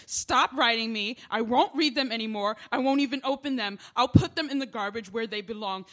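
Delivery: loud, raging yell at him; imagined furious yell. Pretend. Woman imagines yelling at the man who wrote her. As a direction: scream it at the letter writer in fury. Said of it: "I was going to yell".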